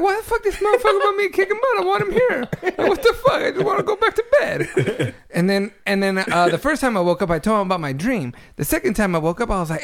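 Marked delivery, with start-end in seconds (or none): 0.0-5.1 very high, hammed-up complaint; imitated whiny grumbling, pitched way up; mock exasperation. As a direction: protest in a high, incredulous voice. Acts out annoyed dream reaction.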